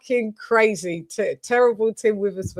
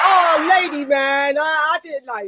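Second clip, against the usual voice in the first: imitating Tim; 6 semitones higher than usual. Host imitates Tim once more. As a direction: yell it like Tim did. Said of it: "He was like".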